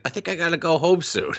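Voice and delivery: high, put-on kid voice; reluctant kid friend. Friend about to leave, high sheepish kid imitation. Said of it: "he said it like the friend".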